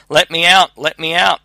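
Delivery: narrated cry from a trapped creature. A narrator's reading. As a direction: cry out urgently to be freed. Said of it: "it cried anew".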